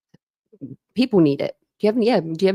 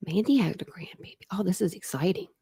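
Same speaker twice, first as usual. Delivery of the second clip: whisper; 7 dB quieter than usual. Real, not acted. Whispered excitement to chat.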